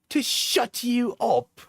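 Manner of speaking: high, drawn-out mock British accent; put-on accent. Acting out an argument by text, high slow British accent. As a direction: stretch it out in affected British. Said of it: "when I'm doing the accent".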